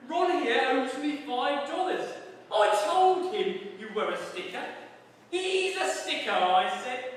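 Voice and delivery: over-the-top jolly jailer; gloating warder. Acted. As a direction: brag about winning the bet. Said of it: "said one of them".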